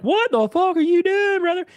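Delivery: high, loud mock disbelief at a friend; incredulous friend. To a friend who quit his hobbies, shocked high squeal. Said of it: "And I'm like".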